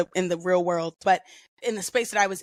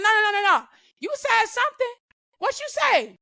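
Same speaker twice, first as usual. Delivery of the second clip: much higher, louder demanding bark; drill sergeant yelling; 12 semitones higher, 3 dB louder than usual. Retold or imitated. Drill sergeant pressing the private on what she said. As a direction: shout him down like a drill sergeant. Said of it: "He's like".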